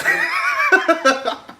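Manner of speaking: shrieking burst of laughter; loud laugh. Real, not acted.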